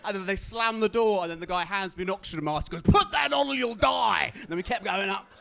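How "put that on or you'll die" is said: higher, louder, slow shouted warning; mock urgent shout. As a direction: yell the warning over the engine. Guy hands oxygen mask.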